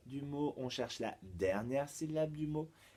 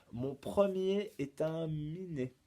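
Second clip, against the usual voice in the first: "Mon premier est un minet" slow and higher; riddle recital; 5 semitones higher, slower than usual. Shown as a demonstration. Teacher poses a charade to pupils, slow raised recital. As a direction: present the clue deliberately, stretching each word. Presents riddle, then repeats it.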